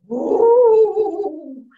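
big, loud ghostly woo; Papa Ghost wail. Retold or imitated. Papa Ghost haunts the house, loud wail. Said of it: "Papa Ghost would go".

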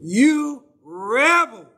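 loud, slow, drawn-out shout; angry shout. Retold or imitated. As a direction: bark it at the crowd, stretching each word. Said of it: "And he didn't say it nicely".

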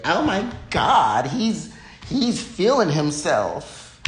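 high, slow, mocking offended voice; mock offended gasp. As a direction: clutch your pearls, slowly, way up high. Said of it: "be like".

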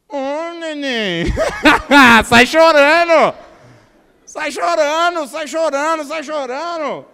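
loud, laughing mock pity for a crybaby; preacher mocks a sulky church member as a crybaby, laughing and loud; mocking baby talk. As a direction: tease him like a whining baby, slow and loud. Mocking a sulking church singer.

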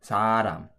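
사람 read with a Korean flap; Korean word. Shown as a demonstration. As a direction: say 사람 as native Korean saram. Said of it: "This would read".

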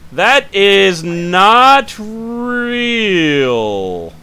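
high, loud and very slow, dragging each word; drawn-out disbelief. Real, not acted. Guard scoffing at a traveler's fake passport, drawn out high and loud. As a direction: stretch it out in mock outrage.

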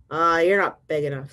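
loud, dismissive storybook brush-off; grouchy ladybug. Acted. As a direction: brush them off like a huffy bug. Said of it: "said the grouchy ladybug".

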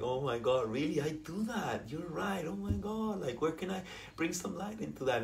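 soft, gentle self-aware voice; mock gentle realization. Teacher showing how to laugh at yourself, soft and gentle. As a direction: quietly marvel at your own habit. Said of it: "but like".

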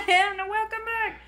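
high, bright mock host voice, restarting the intro. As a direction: pretend the clip was rewound and greet them again.

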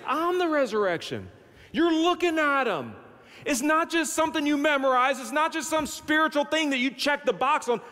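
high, insistent proclamation; emphatic declaration. Acted. Jesus correcting Martha's rote answer, in high emphatic declaration. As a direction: drive it home, pitch held high. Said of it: "He probably didn't hit the pulpit, but he probably said".

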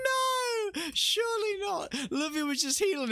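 high disbelieving yell; real stunned outcry; shocked cry. Real, not acted. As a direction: cry out at the twist. Caster sees shock decloak.

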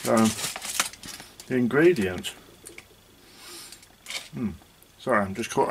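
chewing between words; talking while chewing. Real, not acted. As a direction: mouth partly full of chocolate. Seen 0: Cheek bulges, lips pressed at start; chewing fades by mid-phrase.

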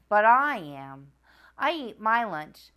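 plain reading voice

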